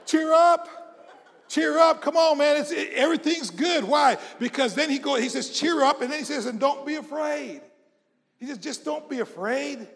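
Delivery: high, loud preacher's exhortation; rousing preaching. Real, not acted. Preacher telling the congregation not to fear, rousing preaching.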